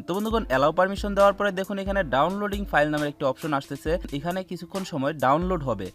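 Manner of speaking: normal talking voice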